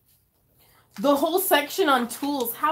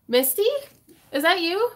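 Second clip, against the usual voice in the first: usual speaking voice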